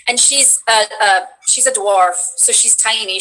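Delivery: usual speaking voice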